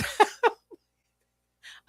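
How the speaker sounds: real chuckle breaking into the sentence; laughing. Real, not acted. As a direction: crack up briefly mid-explanation. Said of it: "I'm laughing because".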